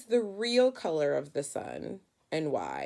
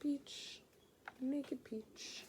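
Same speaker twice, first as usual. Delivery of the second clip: amused whisper; 6 semitones higher, 14 dB quieter, much slower than usual. Real, not acted. Teacher whispering a joke about the naked crayon to the class. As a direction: whisper it for a giggle.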